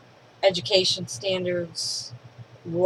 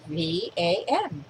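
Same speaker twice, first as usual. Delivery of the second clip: slow spelling; 2 semitones lower, much slower than usual. Retold or imitated. Kid spells letters out.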